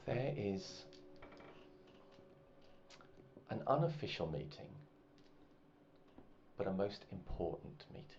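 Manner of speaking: soft, measured, conspiratorial voice; a noble woman quietly inviting the player to a secret meeting; hushed secret invitation. Acted. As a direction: draw him in with a secret.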